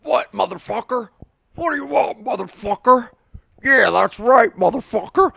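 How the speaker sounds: swaggering mock trash talk; mock tough-guy taunt. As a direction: play the pitcher egging him on. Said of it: "The pitcher is like".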